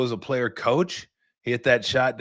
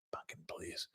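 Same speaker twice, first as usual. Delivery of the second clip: whispered scoff; 4 semitones lower, 24 dB quieter than usual. Real, not acted. Scoffing aside.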